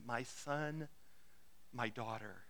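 soft, low, tender address; gently, as to a beloved child. Retold or imitated. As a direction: let it sink low and soft, full of care.

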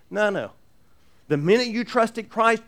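usual speaking voice

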